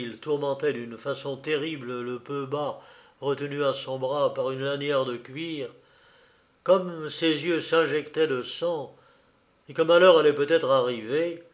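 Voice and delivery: ordinary delivery, nothing marked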